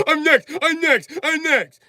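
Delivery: high, slow squeaky mock chant; squeaky high voice mocking eager trolls. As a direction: mimic chatters begging to be next. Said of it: "and they was like".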